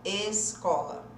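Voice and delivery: "Escola" said slowly, opening on a clear E; slow model word. Shown as a demonstration. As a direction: stretch the E in "Escola". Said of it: "É esse som". ("Escola" devagar, marcando o E)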